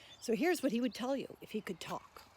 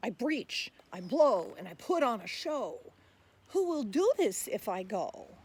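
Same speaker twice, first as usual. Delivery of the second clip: louder, bouncy rhyming voice of the tapir; tapir reciting; 8 dB louder than usual. Acted. Tapir pleading with listeners to be saved, lively recital. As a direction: speak as the friendly tapir asking for help. Whale speaks its poem.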